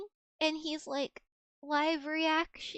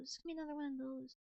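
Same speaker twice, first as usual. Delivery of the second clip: fast, much softer low mutter; muttered aside; 3 semitones lower, 10 dB quieter, much faster than usual. Real, not acted. Streamer muttering to herself, fast and soft. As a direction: say it quickly under your breath.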